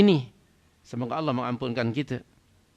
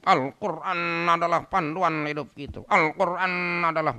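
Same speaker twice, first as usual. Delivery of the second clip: mock eloquent orator; 5 semitones higher than usual. Preacher mimicking how eloquently we talk about the Quran, mock oratory. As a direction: parody a fluent speaker, stretching the words. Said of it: "Wah, kalau cakap".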